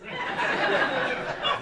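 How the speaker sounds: high loud forced ha ha; mock the audience with a hollow laugh; shrill fake laugh; fake sarcastic laugh. Shown as a demonstration. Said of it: "That is your next joke".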